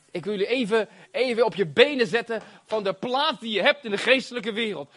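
ordinary talk

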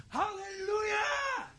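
high, drawn-out hallelujah cry; schreeuwen. Shown as a demonstration. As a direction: cry it out slowly, very high, straining the voice. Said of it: "heerlijk om te schreeuwen". (langgerekte hoge juichkreet)

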